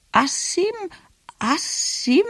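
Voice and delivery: high, halting attempts; stumbling grandmother. A narrator's reading. Grandmother attempts a long unfamiliar word, stumbling and high. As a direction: try the word out in a high questioning voice.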